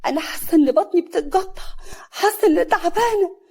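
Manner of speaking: high, loud groaning complaint; in pain. A narrator's reading. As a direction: wail as if the belly is cramping. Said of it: "كريمة بألم".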